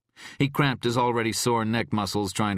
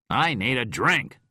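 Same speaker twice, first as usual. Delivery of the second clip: stubborn demand; 7 semitones higher, 5 dB louder than usual. A narrator's reading. Drunk Rhyme demands.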